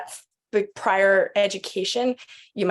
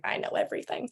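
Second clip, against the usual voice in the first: whispered mock know-it-all; a whispered imitation of a cocky newcomer; smug know-it-all; 9 dB quieter, faster than usual. Mocking a know-it-all attendee.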